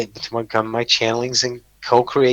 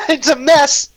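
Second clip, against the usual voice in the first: high, loud laugh through the words; cracking up at himself; 13 semitones higher, 6 dB louder than usual. Real, not acted. Laughing at his own ugly castle, high and loud.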